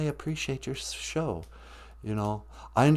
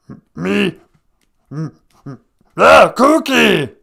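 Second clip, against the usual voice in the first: much higher shouted slow monster growl; Cookie Monster; 8 semitones higher, 11 dB louder, slower than usual. Acted. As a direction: bellow for cookies like a ravenous monster. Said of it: "just be real".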